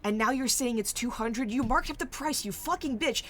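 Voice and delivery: loud, furious haggler yelling over a price; angry customer shouting. Acted. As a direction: yell it like you've been cheated. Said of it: "one person just goes".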